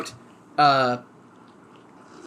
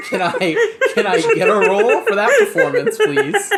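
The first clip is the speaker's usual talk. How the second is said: high, loud laughter through the words; laughing; 7 dB louder than usual. Real, not acted. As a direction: ask while cracking up. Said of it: "GM laughing while asking".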